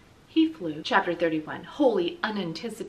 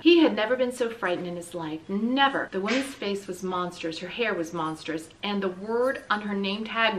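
ordinary delivery

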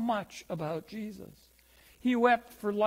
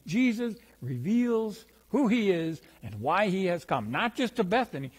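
ordinary delivery

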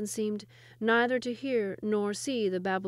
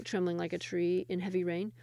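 usual speaking voice

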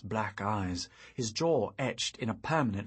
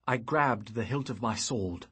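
ordinary delivery

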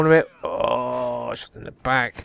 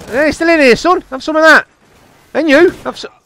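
high-pitched gloating at a victim; excited taunting; 13 semitones higher, 3 dB louder than usual. Real, not acted. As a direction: taunt them as you score. Gloating over kills in game.

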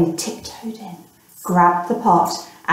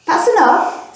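put-on boy calling out; 9 semitones higher than usual. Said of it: "said the boy".

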